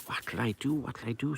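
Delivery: very soft, secretive question; hushed pleading. A narrator's reading. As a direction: ask it furtively under your breath. Princess secretly asks the ring.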